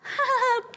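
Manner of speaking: high laugh cut off by a raspberry; short squeaky laugh into a lip-fart noise; imitated laugh-and-fart. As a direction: mimic laughing and farting at once. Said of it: "It's like a".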